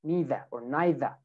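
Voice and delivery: British "neither" two ways, "nee-thuh" then "nye-thuh", no R; British accent. Shown as a demonstration. Teacher models UK "neither" both ways, final R dropped. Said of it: "But in U K".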